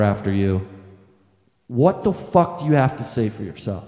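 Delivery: a low, measured stern father; stern dad. Retold or imitated. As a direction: lay out the charges like a furious father. Stern dad confronting the boys.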